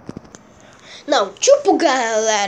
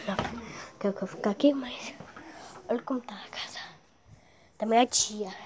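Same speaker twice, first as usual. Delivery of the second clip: hushed whisper, much softer; low whisper in a sleeping house; 8 semitones lower, 12 dB quieter, faster than usual. Real, not acted. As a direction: whisper so you don't wake dad. Dad sleeping nearby.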